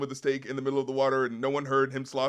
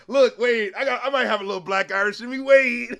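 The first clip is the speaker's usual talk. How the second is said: loud, higher excited self-quote; excited mock quote; 6 semitones higher, 7 dB louder than usual. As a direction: burst out with it. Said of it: "I was like".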